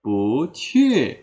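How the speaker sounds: "Bu qu" rising, then sharply falling; correct second-tone bu. Shown as a demonstration. Teacher giving the fix, "Bu qu" rising then falling. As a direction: glide "Bu" up, drop "qu". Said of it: "akan berubah menjadi pu".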